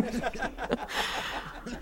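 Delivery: delighted laugh. Real, not acted. Wife laughing at the memory of Father, laughing. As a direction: burst of real laughter at the memory.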